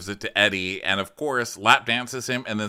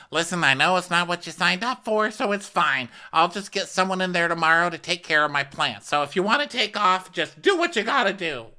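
high, louder dismissive voice; mock Eddie; 10 semitones higher, 4 dB louder than usual. Eddie telling his girlfriend to leave, high mocking voice. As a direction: brush her off in a high mocking tone.

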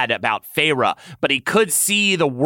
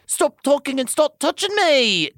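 much higher, urgent command; ordered; 14 semitones higher than usual. Acted. Tamlin ordering Lucien, urgent command. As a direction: snap the order out. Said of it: "Tamlin ordered".

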